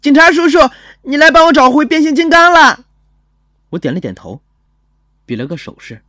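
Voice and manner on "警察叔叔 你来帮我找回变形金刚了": put-on very high, loud childish shout; excited boy. Boy greeting the officer who came, excited shout. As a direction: shriek it with delight and hope. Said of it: "那孩子一激灵跳起来， 两眼放光".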